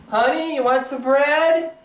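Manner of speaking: high, loud, slow call. Real, not acted. Husband offering his wife bread, loud call. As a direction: call the question across the house.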